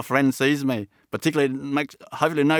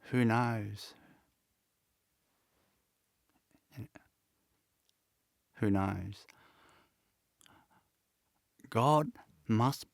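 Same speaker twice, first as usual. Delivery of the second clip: soft and low, with long pauses; real emotion, halting and hushed; choked with emotion; 3 semitones lower, 9 dB quieter than usual. Real, not acted. Man moved by recalling his youthful faith in hardship, emotional and quiet. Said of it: "Sorry, I'm getting emotional".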